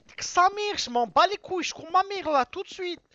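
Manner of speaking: high, loud swearing; furious shout. Real, not acted. Streamer cursing while running to his alter, shouting. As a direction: scream the insult out. Still raging mid-game.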